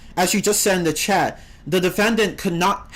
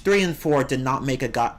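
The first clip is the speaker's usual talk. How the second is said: usual speaking voice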